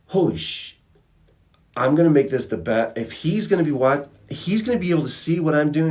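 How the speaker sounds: pumped to outdo his rival; fired-up excitement. Retold or imitated. Rembrandt learning Michelangelo is watching, high excited. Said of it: "And Rembrandt was like".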